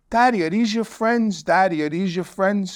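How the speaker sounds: put-on small child asking innocently, drawn out; little daughter. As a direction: ask like a puzzled two-year-old. Toddler daughter voiced in story.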